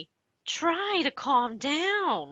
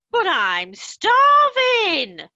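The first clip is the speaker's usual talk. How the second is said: louder, slow, higher complaint; elderly mother loudly protesting she is starving; indignant protest; 6 semitones higher, 5 dB louder than usual. Acted. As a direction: wail it like a hungry old lady. Deaf mother protests about food.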